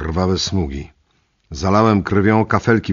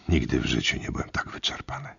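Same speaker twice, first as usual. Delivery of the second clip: spent, barely voiced whisper; exhausted whisper; 5 semitones lower, 8 dB quieter than usual. Worn-out hiker telling of his exhaustion, whispered. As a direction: breathe it out with no strength left.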